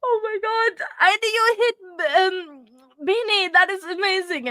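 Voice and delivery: high, loud, breathless amazement; amazed excitement. Real, not acted. Streamer reacting to a viewer's gift, thrilled. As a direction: exclaim in high-pitched delight.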